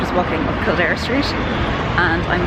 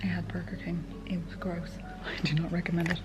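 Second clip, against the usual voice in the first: soft whisper to the camera; whispered vlog; 12 dB quieter than usual. Real, not acted. Whispering to the camera in the clinic waiting room. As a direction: whisper so the clinic can't hear.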